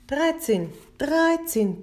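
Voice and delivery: high-pitched narrated outcry without extra volume; restrained bellow. A narrator's reading. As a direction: cry it out high, holding back the volume. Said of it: "brüllte er". (verhaltenes, hohes Brüllen)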